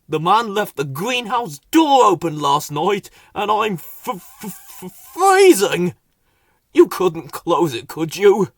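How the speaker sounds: stuttering with cold; teeth-chattering stammer; shivering voice, blue with the cold. Acted. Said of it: "shivering and moaning".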